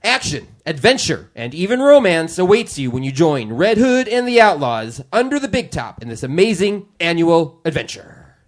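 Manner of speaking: booming step-right-up showman; circus ringmaster. Acted. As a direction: sell the show like a carnival barker. Closes circus announcement.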